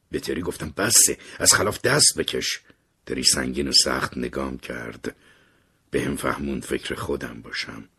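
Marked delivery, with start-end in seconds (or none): none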